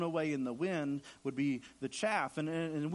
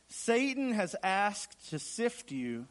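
slow, raised-pitch declaration; solemn pronouncement; 5 semitones higher, much slower than usual. Acted. Jesus looks at his disciple, slowly pronouncing a warning. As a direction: draw out each word as a weighty warning.

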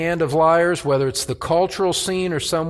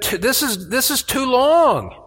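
weary lament; 9 semitones higher, 3 dB louder than usual. Acted. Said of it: "He said".

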